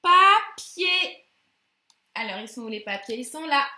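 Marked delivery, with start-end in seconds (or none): 0.0-1.2 Papier said loud and higher; teacher revealing the rebus answer. Shown as a demonstration. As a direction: announce Papier triumphantly.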